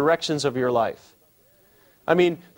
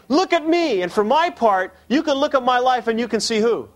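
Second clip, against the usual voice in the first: high, insistent call to look at the speaker; emphatic, attention-calling; 7 semitones higher than usual. Acted. Preacher as Paul urging Corinthians to look at him, emphatic. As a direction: press the point in a raised, emphatic pitch. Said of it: "He's calling attention to himself".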